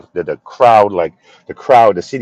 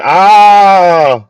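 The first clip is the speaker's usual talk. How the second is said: drawn-out ah; 10 semitones higher, 10 dB louder than usual. Real, not acted. Reacting to English viewer.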